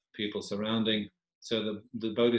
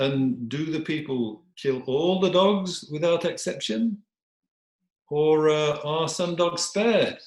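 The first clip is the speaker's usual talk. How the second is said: slow, raised, leading question; probing dog; 6 semitones higher, 3 dB louder, slower than usual. Acted. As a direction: draw out each phrase as you probe. Dog probes the king.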